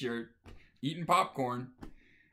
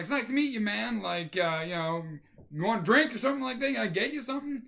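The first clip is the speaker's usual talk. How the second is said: much higher, fast, cheerfully over the top; overly friendly host; 8 semitones higher, 3 dB louder than usual. Retold or imitated. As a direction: smother him with fake hospitality. Offers the date a drink.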